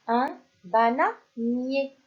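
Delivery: un bananier drawn out slowly, syllable by syllable; slow sounding-out for children; slow dictation. Shown as a demonstration. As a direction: stretch out un bananier so the class hears each sound. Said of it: "teacher sounds out word for class".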